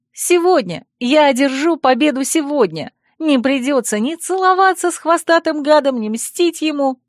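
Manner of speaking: high, excited declaration; jubilant. Acted. Heroine whirls her friend around, declaring victory excitedly. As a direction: proclaim victory with rising excitement.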